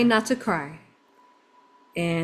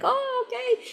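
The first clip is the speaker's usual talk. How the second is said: very high, soft child imitation; little kids' reply; 4 dB quieter than usual. Little boys answering the tourist's ramen wish, high soft kid voice. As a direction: mimic a small child politely accepting. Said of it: "They're like".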